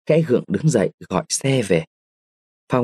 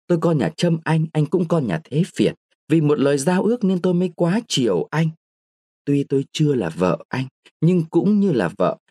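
put-on lightly higher, hurt and defiant girl; indignant young woman; 6 semitones higher than usual. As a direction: protest your honour, wounded. Nguyệt indignant, threatening suicide. (giọng cô gái phẫn uất, tủi nhục)

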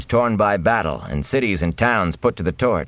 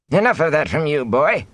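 put-on higher-pitched rebuke to a boy; scolding elder; 9 semitones higher than usual. A narrator's reading. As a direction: tell the boy off. Elder scolds a boy, shaking fist.

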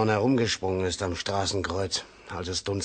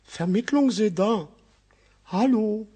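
mimicking the operator; 11 semitones higher than usual. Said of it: "Hast du den Käfer gehört?".

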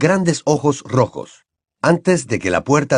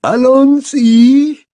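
a slow, raised command in French; stately giantess commanding her pupils, slow and louder; imperious; 14 semitones higher, 7 dB louder, much slower than usual. Acted. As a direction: order her students off grandly. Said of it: "les dijo imperiosamente".

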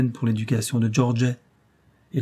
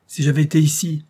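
loud, higher outburst of grief; loud burst of sorrow; anguished cry; 5 semitones higher, 6 dB louder than usual. A narrator's reading. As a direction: cry it out in pain. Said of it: "s'écria George".

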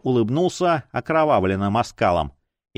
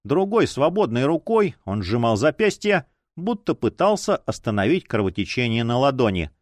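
usual speaking voice

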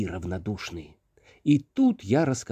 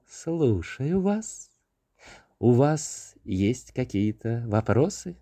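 fairy voice; slower than usual. Acted. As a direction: speak slowly as a calm fairy. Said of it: "голос феи".

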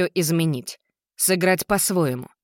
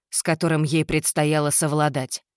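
ordinary delivery, nothing marked

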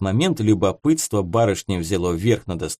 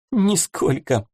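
stifled giggle; 7 semitones higher, slower than usual. A narrator's reading. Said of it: "прыснула в кулачок девушка".

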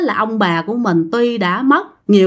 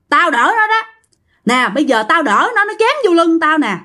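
aggrieved elder's scolding, pitched higher; great-grandfather scolding; 5 semitones higher than usual. Acted. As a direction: reproach the family for making you shield him. Ông cố mắng con cháu trong mơ.

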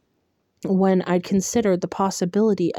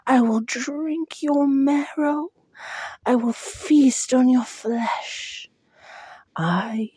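hollow monster voice, higher and slow; ancient creature voice; 6 semitones higher than usual. Acted. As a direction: menace them like an old hungry thing. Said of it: "hollow voice whispered".

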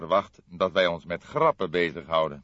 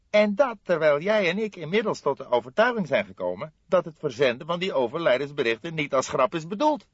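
lightly voiced aggrieved young man, higher; a young colleague, a touch higher and put out; young detective voice; 10 semitones higher, 8 dB louder than usual. Fledder protesting to his older colleague, lightly voiced aggrieved.

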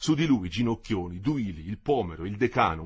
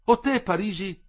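high, louder call across; 10 semitones higher, 6 dB louder than usual. A narrator's reading. Renzoni calls to Parigi from a distance, calling out. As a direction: hail her from afar. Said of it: "lo chiamò il suo amico Renzoni".

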